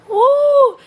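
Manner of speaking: very high, loud whoop; real delighted whoop; excited whoop. Real, not acted. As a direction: let out a loud "Woo!". Laughing hard at own story.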